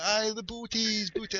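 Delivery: high, soft, drawn-out confirmation; imitated shop worker. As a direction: mimic her confirming the word slowly. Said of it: "And she's giving it".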